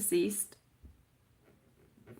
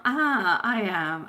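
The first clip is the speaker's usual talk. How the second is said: ordinary talk, nothing marked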